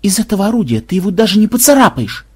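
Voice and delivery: worked-up, incredulous objection; yelling protest. A narrator's reading. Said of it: "завопил Ксенобайт".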